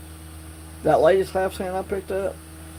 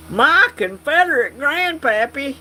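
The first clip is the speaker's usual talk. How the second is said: hammed-up Southern accent, higher, louder and slow; 9 semitones higher, 5 dB louder than usual. Viewer asks to hear his accent, hammed-up Southern accent. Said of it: "Give me that awesome ass accent".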